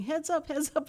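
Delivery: half-voiced shout of alarm; mock warning yell. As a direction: imitate people calling a warning.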